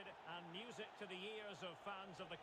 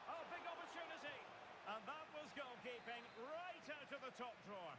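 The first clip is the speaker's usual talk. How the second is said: high-pitched burst at a big chance; excited commentary; 6 semitones higher than usual. Real, not acted. Commentator calling a big chance and save, excited. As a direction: leap up in pitch, then marvel at the save.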